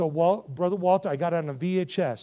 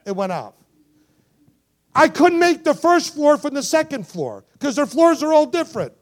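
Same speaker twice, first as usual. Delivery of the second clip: loud, high-pitched preaching delivery; impassioned preaching; 8 semitones higher, 12 dB louder than usual. Acted. Preacher telling the congregation of the ex-communist compound, impassioned preaching. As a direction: preach it at full force.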